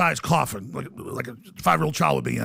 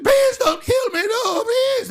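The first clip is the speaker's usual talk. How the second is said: high, drawn-out wailing plea; mock terrified shriek; pleading victim; 3 dB louder, much slower than usual. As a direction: scream for mercy, hammed up. Acted victim begging for life.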